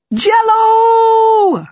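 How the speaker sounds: put-on high, loud child's shriek. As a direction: shriek it like an excited little girl. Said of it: "Alba shrieks".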